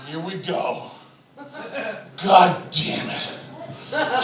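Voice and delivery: soft cursing under the breath; resigned dread. Retold or imitated. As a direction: brace quietly for trouble. Dad dreads wife's reaction.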